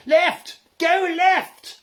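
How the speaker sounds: high, loud frantic scream. A narrator's reading. Angelo screaming directions to the teams, high and loud. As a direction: scream the turn at the drivers. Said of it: "Angelo screamed".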